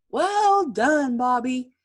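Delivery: high, loud cheer; loud praise. Acted. Teacher praises Bobby, high and loud. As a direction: boom out the praise.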